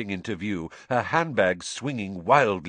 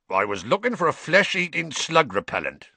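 growling; 7 semitones higher than usual. A narrator's reading. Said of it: "growled Hagrid".

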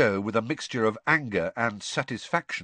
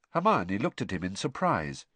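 ordinary delivery

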